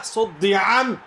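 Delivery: ordinary talk, nothing marked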